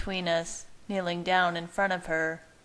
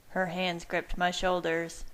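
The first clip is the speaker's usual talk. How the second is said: ordinary delivery, nothing marked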